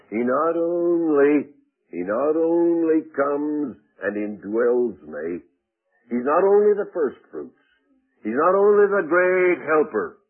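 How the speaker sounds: high, loud, drawn-out pulpit cadence; forceful preaching. Acted. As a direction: hammer each repeated phrase with long, ringing stresses. Preaches in mounting repetitions.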